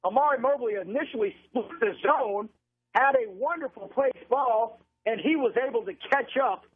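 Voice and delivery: radio football call, pitched above casual chat; live play-by-play. Real, not acted. Announcer calls a defensive play and punt to listeners, live play-by-play. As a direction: call the game live, stretching the key words.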